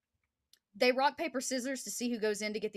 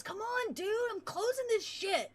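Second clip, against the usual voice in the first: much higher, urgent buddy voice; impatient soldier; 13 semitones higher than usual. Acted. Soldier at the door calling his friend out, high and urgent. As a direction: get him out now, pitched high. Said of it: "He goes".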